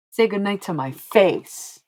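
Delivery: playful mock demand. Real, not acted. To a viewer saying good night, playful mock demand. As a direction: demand it slowly with mock indignation.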